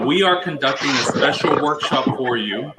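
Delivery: usual speaking voice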